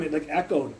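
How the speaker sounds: ordinary talk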